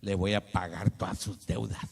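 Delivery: voicing the Lord. Preacher voicing the Lord promising to pay debts, low and firm. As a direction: speak low and steady as the Lord.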